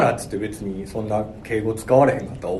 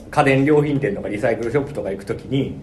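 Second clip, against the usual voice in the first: normal talking voice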